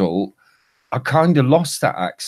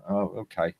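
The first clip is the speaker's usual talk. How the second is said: much softer, small retold reply; meek giving in; 10 dB quieter than usual. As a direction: act out backing down quietly. Acted giving in after the argument.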